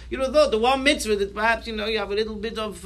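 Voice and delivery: ordinary talk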